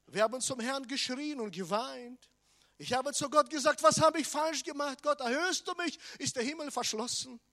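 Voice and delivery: high, loud pleading cry; desperate cry to God. Retold or imitated. Preacher reliving crying out to God, high and loud. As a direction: call out to heaven in anguish.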